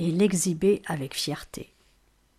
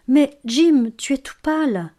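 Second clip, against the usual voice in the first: higher, louder anxious plea; a young wife pleading with her husband, voice raised and anxious; 6 semitones higher, 6 dB louder than usual. A narrator's reading. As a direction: beg him to stop staring. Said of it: "implora Delia".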